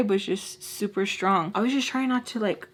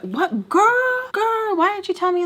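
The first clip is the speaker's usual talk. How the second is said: very high, loud mock outburst; imitating her voice; 11 semitones higher, 4 dB louder than usual. Imitating her on seeing the followers, high astonished voice. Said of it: "So her voice was like".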